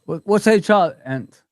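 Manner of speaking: normal talking voice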